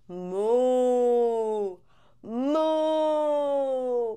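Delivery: low drawn-out moo like a cow. Shown as a demonstration. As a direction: moo for the children. Teacher shows cow sound.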